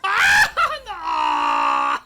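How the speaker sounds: very high, loud shriek; full-volume startled shriek; loud scream. Real, not acted. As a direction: scream in panic at the game. Startled while playing the game.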